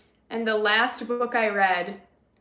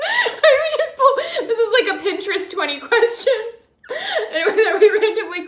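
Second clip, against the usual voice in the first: laughing; 11 semitones higher, 7 dB louder than usual. Real, not acted. Laughing fit while explaining.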